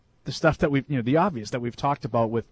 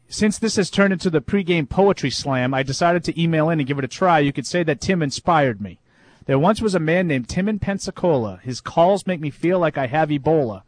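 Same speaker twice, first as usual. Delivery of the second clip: reading a listener's poem with mock drama; 2 semitones higher, 3 dB louder than usual. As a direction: recite it like a bad poetry slam. Reads listener's poem aloud.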